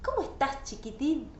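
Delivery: pompous grown-up. Retold or imitated. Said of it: "con un tono de gran personaje".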